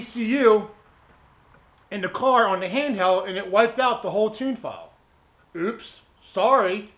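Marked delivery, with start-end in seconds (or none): 5.6-6.9 slow, sarcastic fake sorry; mock apology. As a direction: drag out a sarcastic oops. Mocking the tuner's reaction.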